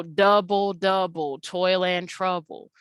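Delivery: "double, double, toil and trouble" in slow, measured beats; regular rhythm. Shown as a demonstration. Teacher contrasting regular meter with jazz, slow rhythmic chant. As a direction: beat out "double, double, toil and trouble" slowly and evenly. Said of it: "to make an irregular rhythm".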